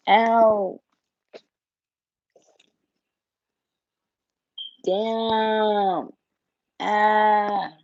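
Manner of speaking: real pain, drawn-out groaning curses; real cramp, cursing through the pain. Real, not acted. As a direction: wince through a sudden cramp. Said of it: "Man, I had a cramp".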